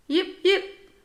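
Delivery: very high yappy yips; small dog yipping. A narrator's reading. Tiny sparkly dog yips at Rosie, very high and yappy. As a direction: yap like a tiny excited dog.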